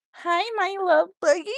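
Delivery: high, loud sing-song calling. Real, not acted. Woman calling out sweetly to the neighbor boy Bentley, high sing-song. Greeting the child.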